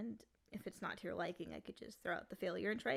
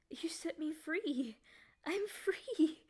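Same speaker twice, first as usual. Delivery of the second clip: rising higher and louder into joy; ecstatic; 7 semitones higher, 8 dB louder, much slower than usual. Acted. Freed demon crying out as the cage opens, elated. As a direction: build from awe to an exultant cry.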